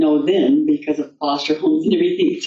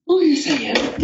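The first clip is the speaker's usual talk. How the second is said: airy Frog denial; 10 semitones higher than usual. Acted. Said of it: "And Frog says".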